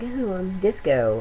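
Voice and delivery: lightly voiced flamboyant disco guy; a hint of camp; mock disco hair voice. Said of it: "the hair that's like".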